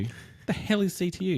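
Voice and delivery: high, incredulous question; mock disbelief; baffled. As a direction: sound totally thrown by the badge. Cop baffled by badge.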